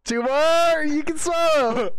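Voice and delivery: high, loud cheering; excited shout. Real, not acted. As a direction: cheer him over the line. Cheering the eater on.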